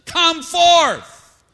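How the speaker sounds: booming high shout, drawn out; loud, high-pitched commanding call; commanding pronouncement. Acted. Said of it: "onto the pronouncement".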